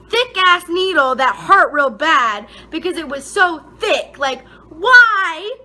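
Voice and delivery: venting about the pain; exasperated complaint. Real, not acted. Complaining about painful needle.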